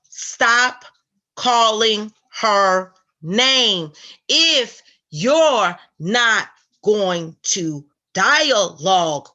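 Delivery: very slow, loud, word by word; emphatic, drawn-out ultimatum; stern retold warning. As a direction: lay down the warning one heavy word at a time. Quotes a stern warning to her.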